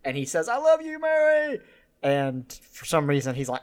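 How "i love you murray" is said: high adoring call. Retold or imitated. Arthur in the crowd calling to Murray, very high and adoring. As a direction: call out to your idol.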